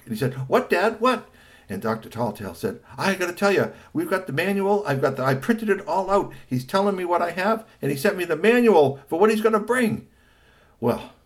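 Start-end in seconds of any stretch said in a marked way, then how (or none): 0.5-1.3 high, loud eager question; a touch of excited kid; eager boy. Acted. Son asks father for news, eager. 3.0-10.1 high, loud eager explaining; excited father. A narrator's reading. Father tells family about manual, excited. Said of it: "he was excited".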